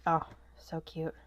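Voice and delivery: normal talking voice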